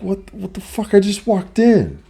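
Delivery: high, baffled protest; incredulous retort. Retold or imitated. Son protesting mom's rule, high and incredulous. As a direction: squeak out disbelief at the unfair order. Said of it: "I'm like".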